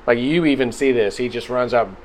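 ordinary talk, nothing marked

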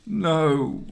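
high cry of despair; anguished cry. A narrator's reading. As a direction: cry out in grief, pitch leaping up. Husband sees his wife dead.

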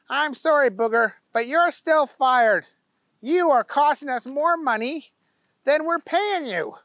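firing him regretfully; firm boss. A narrator's reading. Owner fires Booger.

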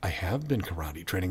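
acted realization, very soft and low; hushed realization. Trainee in his moment of realization, soft and low. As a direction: say it under the weight of discovery. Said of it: "Of realization".

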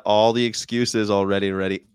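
ordinary talk, nothing marked

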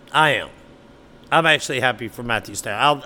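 ordinary delivery

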